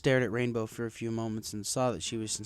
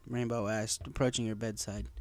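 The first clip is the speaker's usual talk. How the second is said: usual speaking voice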